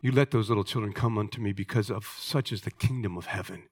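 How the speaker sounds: low, hushed stern warning; a quiet, stern Jesus voice, no yelling; mild stern rebuke. Retold or imitated. Jesus rebuking disciples who turned children away, low and stern. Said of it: "Jesus gets enraged".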